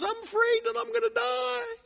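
tiny, very high squeaky voice; high-pitched little mouse in fear; frightened baby mouse. Acted.

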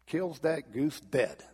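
ordinary talk

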